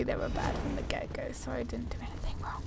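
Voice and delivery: fast guilty whisper to yourself; hurried conspiratorial whisper; sly whisper. Real, not acted. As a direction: whisper the excuse quickly, as if confessing. Guilty aside justifying in-game action.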